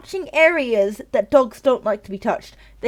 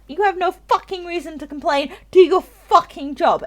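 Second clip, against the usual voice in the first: angry rant; 8 semitones higher, 3 dB louder than usual. Real, not acted. Ranting at lazy groomers.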